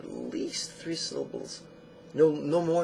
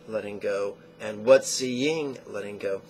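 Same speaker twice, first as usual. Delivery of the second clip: guided practice; 6 dB louder than usual. Shown as a demonstration. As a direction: lead the noting practice unhurried. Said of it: "you don't go that fast".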